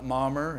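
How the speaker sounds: "mommer" with an R hung on the end, mommer not momma; added R. Shown as a demonstration. Preacher showing how North Alabama folks say "mommer", with an added final R. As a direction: say "mommer" the North Alabama hillbilly way, finishing on a clear R. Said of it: "we add R's to our words".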